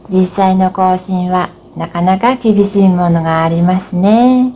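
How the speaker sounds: normal talking voice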